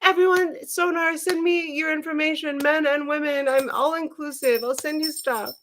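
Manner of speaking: high, even announcing voice; imitating a generous friend. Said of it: "She's like".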